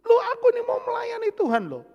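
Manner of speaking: high-pitched wailing complaint to God; mock bawling like a small child; imitated crying. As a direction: plead in a loud, high, whiny sob. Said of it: "Saya nangis di belakang".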